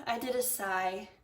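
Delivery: plain reading voice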